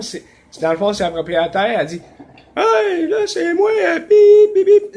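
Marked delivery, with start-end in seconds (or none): 2.6-5.0 much higher, mock landlady voice; little woman's voice. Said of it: "une petite voix de femme". (imitation de la propriétaire avec une petite voix aiguë)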